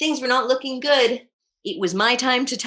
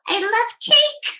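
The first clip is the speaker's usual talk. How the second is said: excited; 11 semitones higher, 5 dB louder, slower than usual. Real, not acted. Child gasps excitedly over cake.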